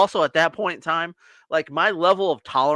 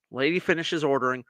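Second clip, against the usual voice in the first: ordinary delivery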